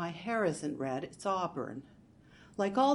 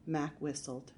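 ordinary delivery, nothing marked

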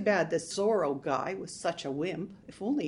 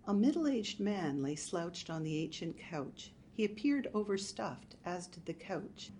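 usual speaking voice

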